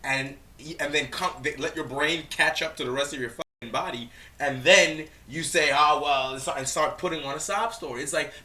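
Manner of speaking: loud, rising indignant rant; worked-up rant at the clip; heated rant. Real, not acted. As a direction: tear into her choices, voice climbing. Heated rant about her choices.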